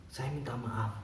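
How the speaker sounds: soft, low apology; apologetic. Acted. Bystander apologising to angry janitor.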